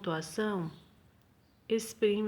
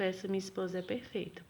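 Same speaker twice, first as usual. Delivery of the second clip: direto e rápido; 7 dB quieter, faster than usual. Shown as a demonstration. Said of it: "na primeira frase ele foi bem direto".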